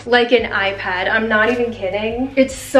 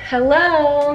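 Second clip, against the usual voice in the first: excited hello; 5 semitones higher than usual. Real, not acted. Greeting the new iMac.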